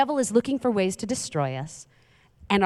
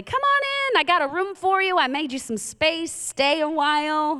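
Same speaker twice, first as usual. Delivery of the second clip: much higher, sing-song hostess welcome; mock welcome; 8 semitones higher than usual. Pastor acting out inviting the devil into a room. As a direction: gush like an eager host.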